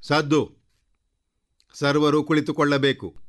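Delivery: high-pitched herald's call; soldiers' shouted order. Acted. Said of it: "ಎಲ್ಲ ಭಟರು ಒಟ್ಟಿಗೆ ಕೂಗಿದ್ದರಿಂದ".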